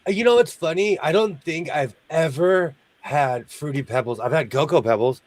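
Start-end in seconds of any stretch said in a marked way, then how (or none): none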